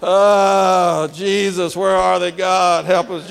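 hammed-up desperate planter praying; mock panicked prayer. Lonely church planter praying in empty church, mock panic. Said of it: "And you're like".

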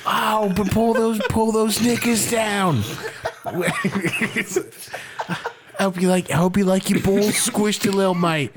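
lightly done Aussie bloke, half sung; Mister Everest voice. Acted. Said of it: "so much fun to do that voice".

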